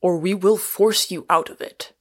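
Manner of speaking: understated furious threat; contained, forceful anger; sudden fury. A narrator's reading. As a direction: threaten angrily without raising the pitch. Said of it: "with a sudden fury".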